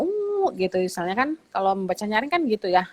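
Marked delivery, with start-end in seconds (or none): 0.0-0.6 high, held elephant trumpet "uuu"; high hooting elephant noise; elephant call. Shown as a demonstration. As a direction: imitate an elephant calling, pitched way up. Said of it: "gitu toh bunyinya gajah".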